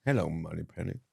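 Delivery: low, soft Bond line out the side of the mouth. Retold or imitated. Copying the Bond impression, talking out the side of mouth. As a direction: mumble it suavely from the corner of the mouth. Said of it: "Why are we talking out the side of our mouth".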